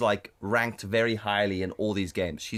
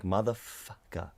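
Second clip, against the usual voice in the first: inwardly seething; 2 semitones lower, 7 dB quieter, slower than usual. Retold or imitated. Sore loser cursing his win inside, seething. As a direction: draw it out under the breath, privately furious. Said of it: "but, like, inside, she was like".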